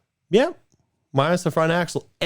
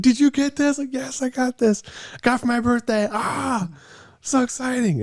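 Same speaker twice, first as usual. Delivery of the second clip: much higher, bubbly excited voice; giddy excitement. Retold or imitated. Retelling her own excited reply about the birthday Jeep, high and giddy. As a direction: replay the thrilled squeal of showing off a new car. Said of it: "I was like".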